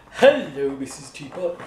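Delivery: low, lumbering elephant voice; shy elephant. Acted. Elephant greets teapot, low.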